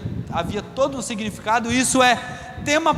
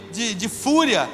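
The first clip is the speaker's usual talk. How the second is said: normal talking voice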